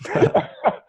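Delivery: a high, airless burst of laughter; high breathy laugh. Real, not acted. Laughing while telling the guest about getting recognized, high breathy laugh. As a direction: laugh up high with barely any voice.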